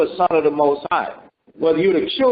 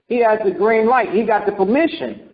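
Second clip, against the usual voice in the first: ordinary talk, nothing marked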